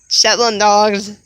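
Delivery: usual speaking voice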